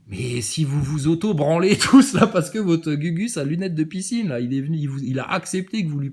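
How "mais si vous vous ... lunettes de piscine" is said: laughing mockery. Real, not acted. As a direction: laugh at them while saying it, louder. Mocking followers, laughing.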